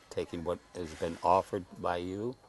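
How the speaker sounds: normal talking voice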